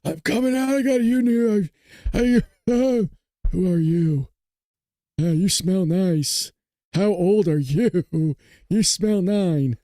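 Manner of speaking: high, drawn-out comic politician voice; slow, rambling caricature of an elderly president; Joe Biden impression. Streamer mimicking the former president for laughs, high comic impression. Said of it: "I think my Biden impression".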